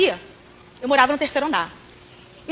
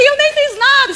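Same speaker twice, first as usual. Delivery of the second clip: put-on high, louder protesting child voice; whiny innocent girl; 10 semitones higher, 7 dB louder, much slower than usual. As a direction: play the wronged little girl. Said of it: "ela ficava assim". (menina choramingando inocente)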